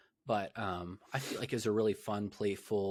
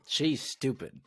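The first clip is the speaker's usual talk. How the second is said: loud, higher mock dismissal; Tech; 6 semitones higher, 6 dB louder, slower than usual. Host acting Tech writing off an admirer, loud mock scorn. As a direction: blurt it out as a dismissive nerd. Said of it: "He's like".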